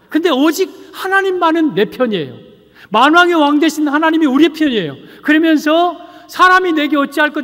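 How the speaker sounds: high-pitched, emphatic pulpit proclamation; impassioned preaching. Real, not acted. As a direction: proclaim it boldly to the congregation. Preaches at raised force.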